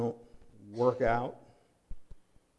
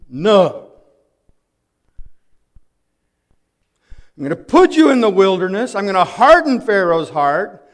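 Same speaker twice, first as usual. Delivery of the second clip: loud, higher, forceful divine proclamation; God declaring; 6 semitones higher, 13 dB louder than usual. Acted. God telling Israel his plan, loud and commanding. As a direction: pronounce it like God laying down the plan.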